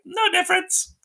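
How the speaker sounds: high, hammed-up flat dismissal; mock dismissive soldiers. Soldiers brushing off Andre, high mock dismissal. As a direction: toss it off in a silly high voice. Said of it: "And they're like".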